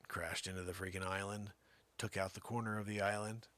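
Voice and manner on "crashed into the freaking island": ordinary talk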